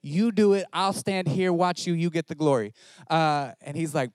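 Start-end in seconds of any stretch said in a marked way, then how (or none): 0.0-2.7 pleading protest. Retold or imitated. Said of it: "I was like".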